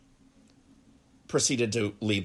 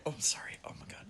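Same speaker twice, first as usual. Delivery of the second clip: whispering; 4 semitones higher, 13 dB quieter, much faster than usual. Real, not acted. I'm cramping up.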